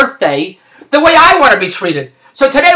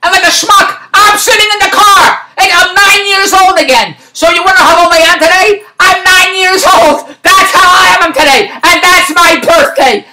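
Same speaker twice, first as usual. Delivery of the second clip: worked-up comic indignation; exasperated rant; 5 semitones higher than usual. Real, not acted. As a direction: stretch out each grievance, pitched up. Climax of birthday rant.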